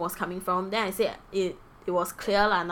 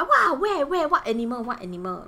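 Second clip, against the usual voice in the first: excited; 6 semitones higher, 5 dB louder than usual. Retold or imitated. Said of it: "everyone will be like".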